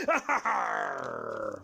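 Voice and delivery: deep, slow villain cackle; evil laugh. Acted. Pirate gloating over his plan, deep evil cackle. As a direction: laugh like a cartoon baddie, drawn out.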